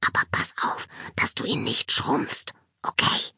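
voiceless cautionary whisper. A narrator's reading. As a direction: whisper a warning to a friend. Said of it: "fügte sie flüsternd hinzu".

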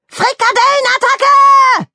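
high, drawn-out bellow; roared battle cry. Acted. Donald Rabbit charging at the bowl of meatballs, roaring. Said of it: "brüllte".

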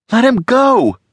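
high sharp loud hiss. A narrator's reading. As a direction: spit the words at him hard. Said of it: "Alex hissed".

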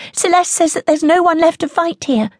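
put-on high, hurried child's voice; worried girl telling her mother the news; rapid little girl. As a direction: let the words bubble out fast. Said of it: "She speaks rapidly".

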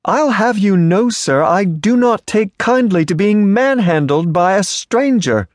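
high, haughty rebuke; prim indignant lady. Acted. Offended lady scolds the stranger who grabbed her, high and haughty.

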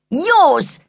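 teasing shouted answer; taunting comeback. A narrator's reading. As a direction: throw it back to wind her up. Brother taunts sister through door.

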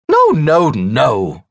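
loud, high dismissal; hostess butting in. A narrator's reading. Margaret interrupting the younger wife, loudly dismissive. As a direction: cut in high and loud, then drop.

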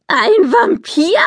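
hoch und gedehnt ungläubig nachgefragt. A narrator's reading. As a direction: Ein Vampir? hoch und langsam fragen, fassungslos. Polly kann es nicht glauben. (Polly wiederholt ungläubig die Worte der Mutter, hoch und gedehnt)

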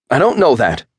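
high, loud, spat out. A narrator's reading. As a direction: spit the words out. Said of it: "Chad spat out".

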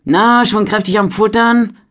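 laut, deutlich höher und fröhlich; gut gelaunt. A narrator's reading. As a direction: neckend gut gelaunt fragen. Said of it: "fragte er gut gelaunt". (gut gelaunt neckend gefragt)

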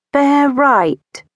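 a boy mimicking a posh British sat-nav; falsetto British accent. Ike playing the GPS lady to his mom, falsetto British accent. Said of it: "The lady that lives in the G P S".

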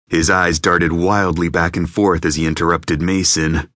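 usual speaking voice

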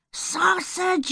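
high, eager shout; a gleeful chorus of sausages; excited shout all at once. A narrator's reading. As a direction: cry it out together, thrilled. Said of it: "said everyone at once".

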